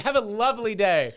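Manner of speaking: high, eager mock pleasantry; overly nice. Customer desperate to please counter worker, overeager niceness. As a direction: chirp it far too politely. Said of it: "I immediately was like".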